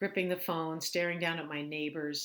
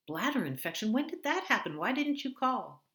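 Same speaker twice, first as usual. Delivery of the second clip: alarmed; 9 semitones higher, 6 dB louder than usual. Acted. Alarmed sister on phone.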